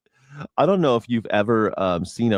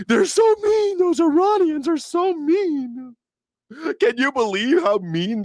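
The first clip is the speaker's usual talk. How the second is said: whiny mock victim. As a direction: pout it like a wounded child.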